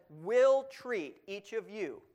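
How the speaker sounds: "will" punched loud and slow; slow down and hammer "will"; stress on will. Shown as a demonstration. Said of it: "not might, not may".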